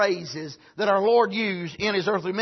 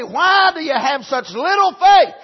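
louder, higher, a rebuking tone on why; mock rebuke; 5 semitones higher, 5 dB louder than usual. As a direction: scold him for his little faith. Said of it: "He didn't rebuke him and say".

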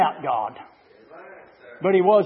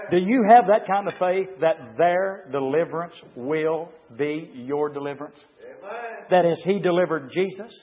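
usual speaking voice

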